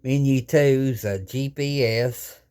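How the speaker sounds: ordinary delivery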